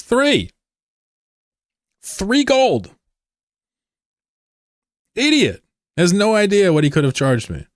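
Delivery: high-pitched, loud incredulous yelling; indignant outburst. Real, not acted. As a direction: shriek in mock outrage at the troll's stupidity. Outraged at troll's cheap toll.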